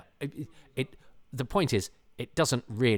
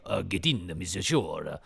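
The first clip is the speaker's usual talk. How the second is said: hammy French attempt that lands Italian; overdone cod-Italian accent with added vowels; botched French accent; 2 semitones lower, slower than usual. Shown as a demonstration. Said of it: "that was more sort of Italian".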